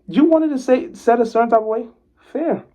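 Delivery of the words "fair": ordinary talk, nothing marked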